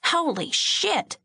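high, slow shocked outburst; shocked exclamation. A narrator's reading. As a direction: blurt it out in horror. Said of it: "Bobby exclaimed".

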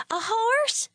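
put-on very high, hopeful child's question; light up at the idea of a horse; eager little girl. A narrator's reading. Daughter asking father for a horse, very high eager child voice.

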